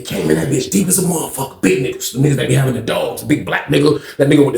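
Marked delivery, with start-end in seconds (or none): none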